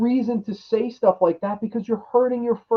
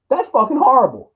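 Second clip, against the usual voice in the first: ordinary talk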